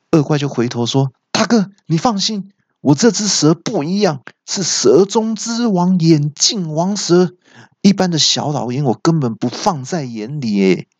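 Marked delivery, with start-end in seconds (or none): 1.3-10.9 high-pitched, cocky bragging; boastful villain. A narrator's reading. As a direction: brag to your big brother with relish. 恶怪向大哥夸口. (高声得意吹嘘)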